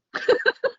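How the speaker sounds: high-pitched giggle; amused burst at her own quote; laughing. Real, not acted. Wife amused retelling her retort, high laugh.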